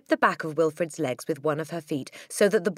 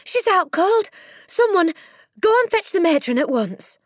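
high, loud, urgent call; alarmed cry; 9 dB louder than usual. A narrator's reading. Miss Honey sending for the matron, crying out. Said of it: "she cried".

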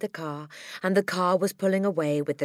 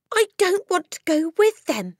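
put-on high, loud childish shout; child shouting out in protest; 14 semitones higher, 8 dB louder than usual. A narrator's reading. Matilda shouts refusal at Miss Honey. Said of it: "Matilda shouted suddenly".

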